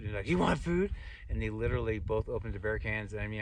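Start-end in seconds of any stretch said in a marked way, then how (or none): 0.3-0.9 high, loud mock-excited question; eager offer. Hikers offering the narrator food, eager offer. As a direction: pitch it up like a delighted offer.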